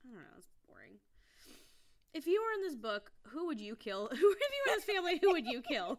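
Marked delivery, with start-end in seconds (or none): 2.1-6.0 high, loud, breaking into laughter; giddy and rushed by the end; amused improvising. Real, not acted. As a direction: blurt it out loudly through laughs. Improvising a livelier question while laughing.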